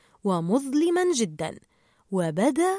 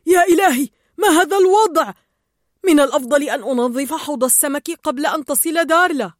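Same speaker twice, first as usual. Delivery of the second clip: loud, higher alarmed cry; shouting; 4 semitones higher, 7 dB louder than usual. Acted. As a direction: dentist yelling at the dirty tank. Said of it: "يصيح". (الطبيب يصيح بصوت عالٍ مذعور)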